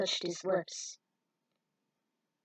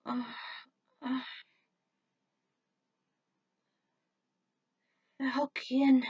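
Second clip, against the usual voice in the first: weak moan; slower than usual. Acted. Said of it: "let out a weak moan".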